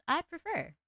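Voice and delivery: high-pitched little gremlin cringe voice. Shown as a demonstration. Streamer showing her preferred voice, high gremlin cringe. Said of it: "cringe voice".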